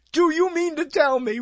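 high-pitched theatrical disbelief; old-movie incredulity. Retold or imitated. Customer challenging the manager, mock-dramatic old-movie incredulity. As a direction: play it like a thirties film actor. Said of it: "that extra bit of something to say".

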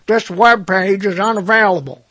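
loud put-on computer announcement voice; error message voice. Browser voicing its error screen, loud announcer voice. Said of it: "that's the voice I hear when I see that".

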